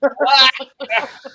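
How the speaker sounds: loud, high laugh breaking out; burst of laughter. Real, not acted. Friend laughing at the in-laws' servants question.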